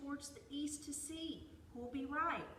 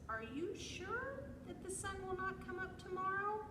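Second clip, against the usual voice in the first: high-pitched squirrel question; doubting squirrel; 11 semitones higher, 4 dB louder than usual. A narrator's reading. Squirrel asks bear about tomorrow's sun, high and doubting. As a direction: ask it high, stretching the doubt.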